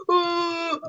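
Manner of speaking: ordinary talk, nothing marked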